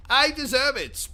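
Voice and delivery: high, loud smug declaration; pompous boast. Acted. Vain host boasting to his viewers, pitched high. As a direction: puff himself up on these words.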